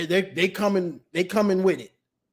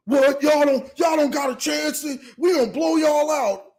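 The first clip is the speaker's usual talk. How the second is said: mock heated retort; 7 semitones higher than usual. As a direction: act the mechanic's angry comeback. Mechanic's angry retort.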